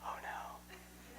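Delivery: breathless whisper of dread. Retold or imitated. Guest dreading the stern grandma, whispering. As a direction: whisper it under your breath, caught out. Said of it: "and I'm like".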